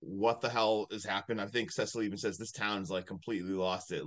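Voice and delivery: low, quiet deadpan; understated, quietly stunned; retold deadpan. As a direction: drop it low and soft, imitating stunned disbelief. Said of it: "just the deadpan look of just".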